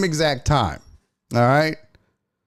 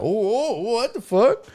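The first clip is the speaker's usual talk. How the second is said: mock shocked; 9 semitones higher, slower than usual. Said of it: "I know he's like".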